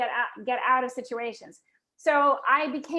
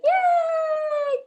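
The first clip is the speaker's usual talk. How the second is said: high, loud squeal of yay; excited yay; 6 dB louder than usual. Retold or imitated. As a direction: shriek yay with joy. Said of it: "And I was like".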